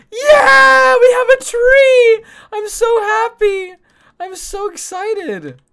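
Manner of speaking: high, slow, tearful joyful shout; crying with joy. Real, not acted. Telling chat about their new tree, sobbing with joy. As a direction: shout it through happy tears.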